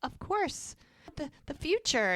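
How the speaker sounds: high, sugary put-on sweetness; a sweet-as-pie fake smile in her voice. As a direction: smile through it, all innocence. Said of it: "sweet as pie, just smiling like".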